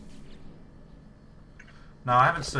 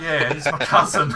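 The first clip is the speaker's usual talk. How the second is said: laughing retelling; 6 semitones higher, 12 dB louder than usual. Retold or imitated. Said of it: "Just straight out".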